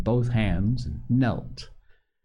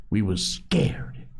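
mildly excited, drawn-out stress on scared; a touch of agitation, stretching the last word; slower than usual. A narrator's reading. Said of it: "said Piggy excitedly".

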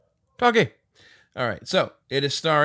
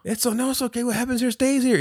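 higher, pushy Uber driver voice; Uber driver Carlos impression; 12 semitones higher than usual. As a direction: play the sleazy driver talking them up, pitched up. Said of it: "this is how he sounded".

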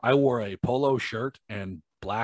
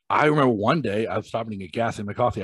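usual speaking voice

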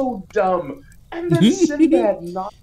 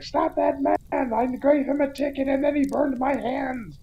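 high-pitched comic cry of pursuit; mock pursuing cop; 8 semitones higher than usual. Cop chasing Arnold, high mock outcry. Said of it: "the cop's running after Arnold, being like".

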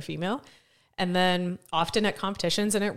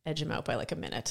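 ordinary delivery

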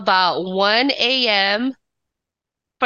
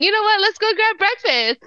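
much higher, bright mock-eager invite; imitating her own eager self, higher; chirpy eager suggestion; 11 semitones higher than usual. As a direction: pitch it up like a keen planner. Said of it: "It was my idea to say".

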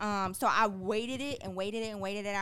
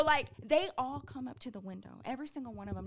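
normal talking voice

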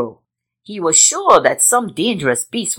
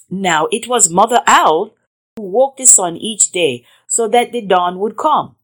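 ordinary delivery

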